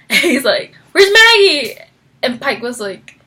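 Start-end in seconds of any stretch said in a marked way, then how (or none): 0.9-1.7 high, loud acted question; imitating a frantic man; urgent demand. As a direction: burst in looking for someone. Voicing Victor's urgent question.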